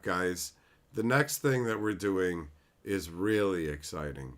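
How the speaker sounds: low, soft, lifeless claim of excitement; wooden PR voice. Retold or imitated. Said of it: "talk in a wooden voice".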